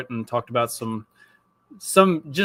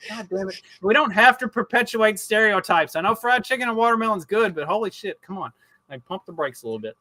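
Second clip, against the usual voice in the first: higher-pitched mock exasperation, easing off at the end; retold exasperated scolding; 7 semitones higher than usual. Dad reacting to daughter's lunch order, retold exasperation. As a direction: plead with the kid, retold in exasperation.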